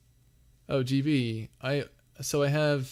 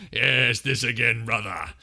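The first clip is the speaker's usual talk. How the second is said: dad joke voice; 6 dB louder than usual. Shown as a demonstration.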